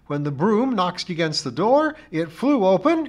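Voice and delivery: high, loud account of a calamity; dramatic char lady. A narrator's reading. Missus Pringle flings her arms apart recounting the accident, dramatic. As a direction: make a great drama of the accident.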